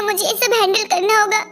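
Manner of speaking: plain reading voice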